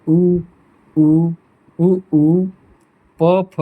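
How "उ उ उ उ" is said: teacher showing the lip sound उ; rounded-lip demo. Shown as a demonstration. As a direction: push उ out through rounded lips. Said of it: "ओष्ठ से जो होठ से होता है".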